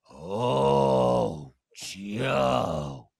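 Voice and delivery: very low, slow drawn-out mock reply; Cirie impression. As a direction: stretch both words out long and deep, imitating her. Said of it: "So all Cirie said was".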